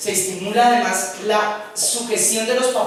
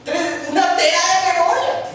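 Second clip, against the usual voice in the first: covetous buyer; 8 semitones higher than usual. Retold or imitated. Tempted buyer voiced.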